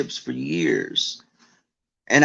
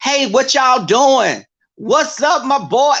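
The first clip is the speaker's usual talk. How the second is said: hip hop slang impression; 11 semitones higher, 4 dB louder than usual. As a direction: ham up a try-hard "what's up my boy" greeting. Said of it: "like, with a hip hop edge".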